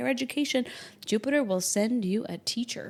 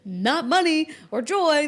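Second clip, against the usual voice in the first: exasperated; 6 semitones higher, 7 dB louder, slower than usual. Retold or imitated. Exasperated reply to astrologers.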